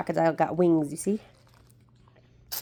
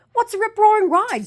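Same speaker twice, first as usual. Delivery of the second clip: put-on high curious child's voice; little girl; 3 dB louder than usual. A narrator's reading. Maddie asking Chimpy about the ride, high and curious. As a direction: ask it high and wide-eyed. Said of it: "said Maddie".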